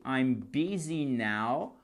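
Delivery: "busy" said slowly as "bizi", short i like in "bit"; slow correct busy. Shown as a demonstration. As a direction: stretch "busy" out slowly as "bizi" to show it right. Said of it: "pas busy".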